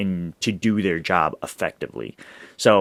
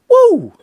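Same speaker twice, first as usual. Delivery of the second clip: high, loud whoop; screamed woo; 10 dB louder than usual. Retold or imitated. Imitating a player at his press conference, screamed whoop. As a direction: let out a soaring, imitated scream. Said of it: "he screams".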